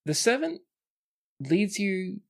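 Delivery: usual speaking voice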